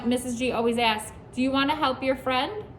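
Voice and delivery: ordinary talk, nothing marked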